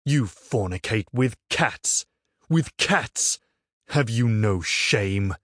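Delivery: scandalised, spit it out; outraged accusation. A narrator's reading. Friar accusing villagers of cat fornication, outraged.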